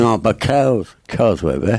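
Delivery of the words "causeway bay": English accent. Shown as a demonstration. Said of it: "C'est un accent anglais, ça".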